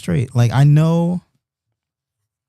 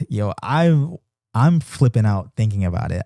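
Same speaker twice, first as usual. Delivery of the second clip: normal talking voice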